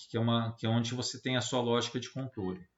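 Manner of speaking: ordinary talk, nothing marked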